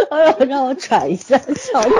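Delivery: gasping words through laughter; can barely talk for laughing; laughing breathlessly. Real, not acted.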